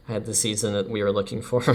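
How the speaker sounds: ordinary talk, nothing marked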